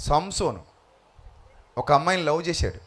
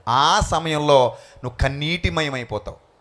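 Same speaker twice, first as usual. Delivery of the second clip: ordinary talk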